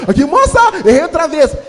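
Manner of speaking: put-on high, loud kid voice; playful high child calling out; childish whine. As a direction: squeal it like a sly kid begging. Kid faking mistakes for cookies.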